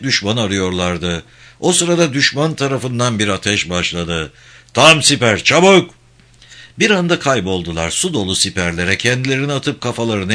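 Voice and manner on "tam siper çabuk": loud, high warning command; urgent shouted order. A narrator's reading. Officer to troops under fire, urgent shout. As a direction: yell for everyone to take cover.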